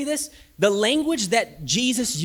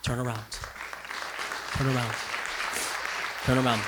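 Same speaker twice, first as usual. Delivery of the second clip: lower, soft repeated call; Jesus tenderly calling the broken back, lower; gentle call; 7 semitones lower, 6 dB quieter, faster than usual. Acted. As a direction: call them home tenderly.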